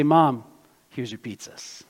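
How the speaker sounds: usual speaking voice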